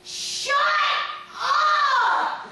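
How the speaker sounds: loud high shout with a laugh; little girl yelling at her crying doll; screaming girl. Retold or imitated. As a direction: scream it into the baby's ear. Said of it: "she screams in the baby's ear".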